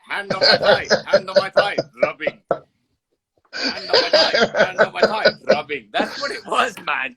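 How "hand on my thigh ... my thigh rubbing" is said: loud, high mocking imitation through laughter; perverted uncle. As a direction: mimic a creepy uncle, laughing. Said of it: "like a perverted uncle".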